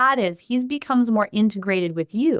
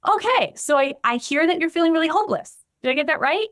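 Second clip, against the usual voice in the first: higher, brisk textbook-listener voice; rote mirroring; 8 semitones higher than usual. Shown as a demonstration. Therapist demo of mechanical mirroring to a hopeless client, higher and brisk. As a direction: parrot the feeling back like a checklist. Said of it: "mirrored you, and I said".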